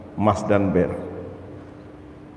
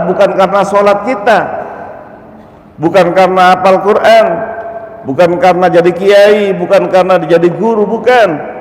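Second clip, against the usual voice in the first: rising preaching fervour; 7 semitones higher than usual. Real, not acted. Preacher building list emphatically.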